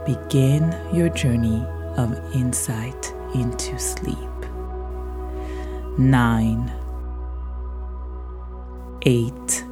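long pauses, soft and whispered numbers; slow soothing countdown. Acted. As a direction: count them down into sleep. Counting down stairs into sleep.

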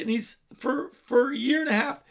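normal talking voice

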